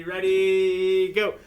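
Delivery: high, very loud count-in; loud group cue. Real, not acted. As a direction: cue the whole class to start together. Cueing class to chant together.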